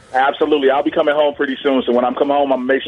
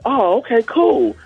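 mock casual reply; 8 semitones higher, slower than usual. [name] reacts, mock impressed.

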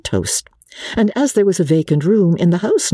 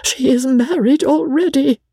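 high-pitched, dismayed announcement; agitated companion, pitched well up; gasped news; 12 semitones higher than usual. A narrator's reading. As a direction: gasp out the shocking news in a high voice. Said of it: "Briggs gasped".

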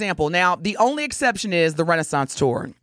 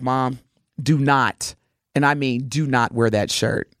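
stern son voice; 7 semitones lower than usual. Retold or imitated. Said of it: "He was like".

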